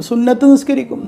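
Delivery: high, plaintive mock-pious mother; imitating tearful mother. Mother telling the ustad of her prayers, imitated high whine. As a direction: mimic a mother's high-pitched lament. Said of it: "എന്നിട്ട് അങ്ങോട്ട് കരയാ".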